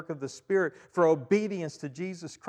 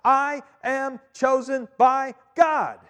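slow, loud, high proclamation; a slow ringing declaration of faith; proclaimed; 10 semitones higher, 10 dB louder, slower than usual. Acted. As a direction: declare it slowly for all to hear. Preacher proclaiming, repeated later.

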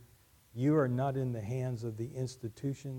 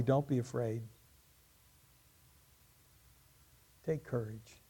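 calm, softer reassurance after the cry; 3 dB quieter than usual. Acted. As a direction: settle the fear with quiet assurance. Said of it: "answered calmly".

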